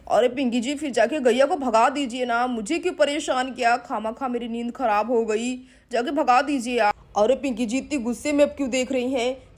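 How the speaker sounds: a drowsy husband grumbling, pitched well down; grumpy sleepy man. Acted. Siddharth grumbles at wife.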